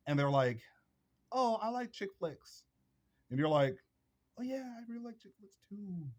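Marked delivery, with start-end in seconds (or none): 1.3-2.6 high, slow airy voice, lightly mocking; mock girly voice. Quoting a group of girls, high mocking girly voice. Girls quoted liking chick flicks. 4.4-6.1 high, soft falsetto mockery; mocking feminine voice. Mocking a man who copies the girls, high soft falsetto.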